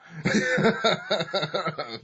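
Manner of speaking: high burst of laughing; burst of laughter. Real, not acted.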